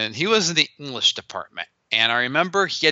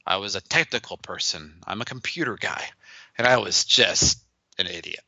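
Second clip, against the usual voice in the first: ordinary talk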